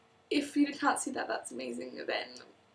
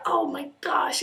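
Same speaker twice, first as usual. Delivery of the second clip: louder, drawn-out imitation excitement; mock gushing; 3 semitones higher, 7 dB louder, slower than usual. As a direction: gush like a starstruck American fan. Mimics Americans gushing about the UK.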